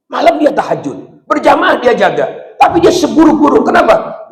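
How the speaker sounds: high, loud sermon build; full-force preacher; fiery preaching. Acted. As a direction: preach it hard to the crowd. Preaching climax on the bad man.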